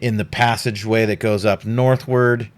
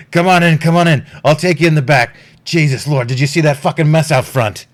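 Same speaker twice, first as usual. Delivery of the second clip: gruff captain; 6 semitones higher, 6 dB louder, faster than usual. Acted. GM voices Hostelon.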